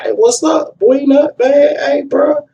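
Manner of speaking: high, eager friendly hails; friendly greeting. Retold or imitated. Dealers greeting the man they know, high and eager. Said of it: "They were like".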